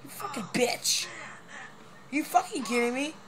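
mock rage. Raging at the game.

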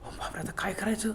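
frightened whisper in the dark; whispered panic after a growl; scared whisper. A narrator's reading. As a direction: breathe it out in fear.

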